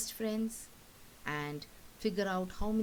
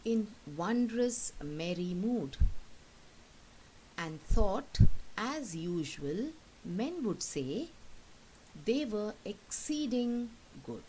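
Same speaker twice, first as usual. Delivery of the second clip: slow, measured poem reading; teacher reading a poem aloud, slowly; poem recitation; 3 semitones higher, 4 dB louder, slower than usual. Acted.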